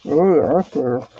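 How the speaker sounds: talking around a brush in her mouth; words muffled by a brush between her teeth; mouth full. Real, not acted. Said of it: "Sorry, I have a brush in my mouth".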